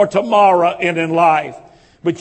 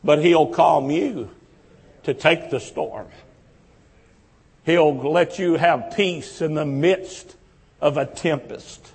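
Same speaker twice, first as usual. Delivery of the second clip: lower, much softer and gentle; tender reassurance; 5 semitones lower, 4 dB quieter than usual. Acted. Pastor soothes a congregation drowning in fear, soft and tender. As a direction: hush down to comfort a frightened soul.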